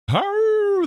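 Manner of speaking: drawn-out high howl on the word. Acted. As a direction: howl it out like a wolf. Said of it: "Did I just howl?".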